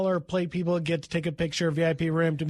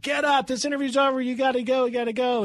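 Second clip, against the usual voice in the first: higher, insistent voice pushing him out; urgent cut-off; 7 semitones higher, faster than usual. Retold or imitated. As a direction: hurry the guy out the door. Cohen ends the interview abruptly.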